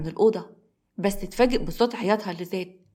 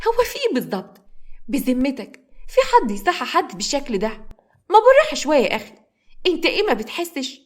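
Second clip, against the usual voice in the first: much higher, fast, exasperated; indignant young woman; 6 semitones higher than usual. A narrator's reading. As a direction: protest sharply. Samra protesting in pain.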